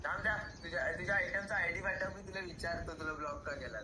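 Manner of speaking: ordinary delivery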